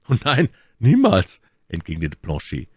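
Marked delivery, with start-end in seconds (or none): 0.1-1.4 high, loud loyal protest; servant's higher, louder indignant denial; emphatic refusal. A narrator's reading. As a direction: protest at once with raised voice.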